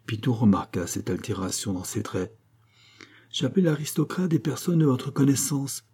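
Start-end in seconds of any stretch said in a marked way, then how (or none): 3.3-5.8 mildly gloating, higher pitched; cruel glee. A narrator's reading. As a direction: needle her with a touch of cruel pleasure. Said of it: "avec une joie cruelle". (joie cruelle contenue)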